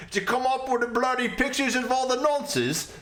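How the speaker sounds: a silly mock British bloke, playing it up for laughs; mock British accent. Answering Blamby's chat as a mock Brit, in a hammed-up British accent.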